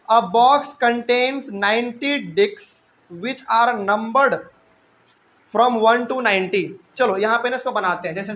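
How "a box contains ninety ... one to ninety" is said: very slow, word by word, like dictating to a class; slow dictation. Shown as a demonstration. Teacher dictating the question to students, very slow and deliberate. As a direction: read the question out slowly so students can write it.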